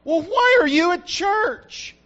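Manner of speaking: slow, high, loud scolding question; hammed-up accusing dad; mock accusing. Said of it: "one of the things I don't do".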